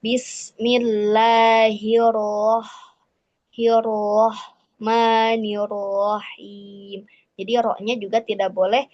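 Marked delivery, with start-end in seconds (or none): 0.0-7.0 bismillahirrohmanirrohim stretched very slowly, ro said without pouting; slow recitation. Shown as a demonstration. Teacher models correct ro in bismillah. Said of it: "saya contohkan".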